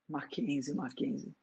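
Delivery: hushed gentle calling; whispered. A narrator's reading. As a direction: breathe his name tenderly. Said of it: "sussurrou a mulher".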